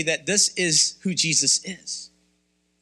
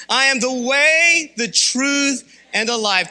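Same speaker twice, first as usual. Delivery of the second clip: louder, slower, "way" punched; loud declaration; 5 semitones higher, 5 dB louder, slower than usual. Shown as a demonstration. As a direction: proclaim it to the rafters. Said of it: "Once more".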